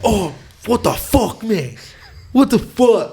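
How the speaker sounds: high, loud and slow indignant yell; a wounded, loud, high protest; outraged complaint. Retold or imitated. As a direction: yell it as if you've just been manhandled. Friend complains after being grabbed.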